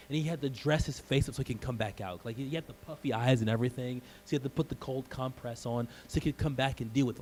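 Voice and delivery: ordinary delivery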